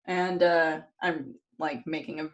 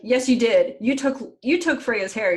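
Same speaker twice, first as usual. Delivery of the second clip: loud, higher retold accusation; mock angry insistence; 5 semitones higher, 7 dB louder than usual. Retelling the gods arguing with Loki, loud mock insistence. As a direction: hammer the accusation home, playing it up.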